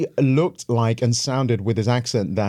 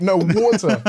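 desperate correction; 8 semitones higher, 3 dB louder than usual. Retold or imitated. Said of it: "I'm like".

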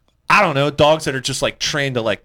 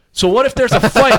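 put-on lecturing the kids; 9 semitones higher, 6 dB louder than usual. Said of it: "I was like".

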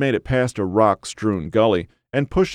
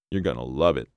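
lightly voiced old man, low and soft; gravelly old uncle; 6 semitones lower, 5 dB quieter, faster than usual. A narrator's reading. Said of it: "the gravelly voice of Ken's uncle".

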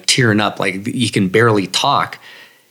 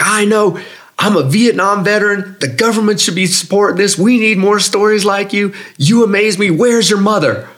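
gushing veteran; 10 semitones higher than usual. Retold or imitated. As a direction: pour out admiration at full pitch.

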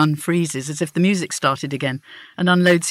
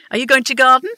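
louder, higher nosy neighbour; abrupt old woman; 8 semitones higher, 6 dB louder, faster than usual. Retold or imitated. Wyn voiced, abrupt.

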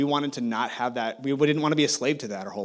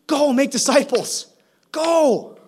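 high, loud, slow preacher's call; preaching; 12 semitones higher, 6 dB louder, much slower than usual. Acted. Pastor urging the church to go, loud preaching. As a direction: urge the congregation to go.

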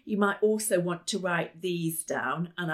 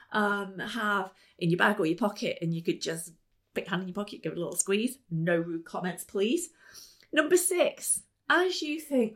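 ordinary talk

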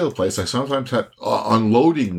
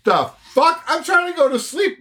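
high, incredulous burst; startled outrage. Real, not acted. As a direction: sound caught off guard and appalled. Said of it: "shocked hearing his boss".

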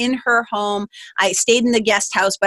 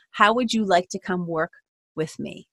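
soft offer; 3 semitones lower, 6 dB quieter than usual. Retold or imitated. Mentor's quiet offer.

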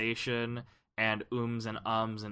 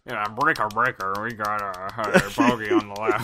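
loud pilot radio call; radio chatter; 2 semitones lower, 7 dB louder than usual. Pretend. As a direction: bark it over the radio. Playing radio chatter in helicopter.

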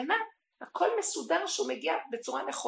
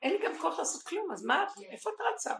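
a hint of high, quick self-scolding; talking to herself; 8 semitones higher than usual. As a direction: tell yourself to sit down. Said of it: "אני אומרת לעצמי".

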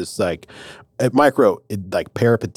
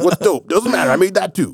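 higher, fast, hyped buddy voice; excited dude God; 10 semitones higher than usual. Pretend. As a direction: cheer it like a stoked friend. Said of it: "And he's like".

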